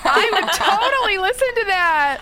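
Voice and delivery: high, loud and laughing; laughing enthusiasm. Real, not acted. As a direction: gush it through real laughter. Excited laughing at the offer.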